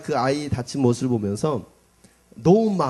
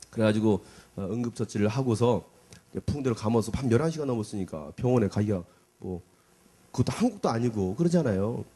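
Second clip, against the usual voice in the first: normal talking voice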